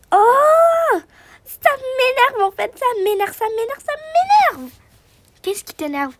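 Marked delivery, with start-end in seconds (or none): 0.1-4.7 plus aigu, lent et appuyé; répéter avec exaspération; agacement exaspéré. Acted. Colère de la femme. (femme exaspérée répétant sa colère)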